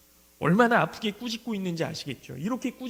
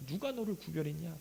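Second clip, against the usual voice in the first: much softer echo of the question, 누가 lightly leaned on; quiet repeat; 3 semitones lower, 10 dB quieter than usual. Shown as a demonstration. Preacher letting the question sink in, soft repeat. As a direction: drop to a hush and ask 누가 너를 again. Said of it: "너가 누구를".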